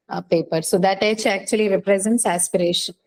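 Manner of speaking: ordinary delivery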